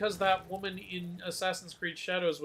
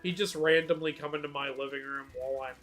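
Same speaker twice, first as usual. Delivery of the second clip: ordinary talk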